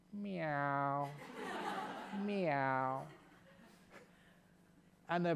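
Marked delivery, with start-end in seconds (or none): 0.1-3.0 soft mock meow, laughing through it; cat meow. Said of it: "the prisoner ... went".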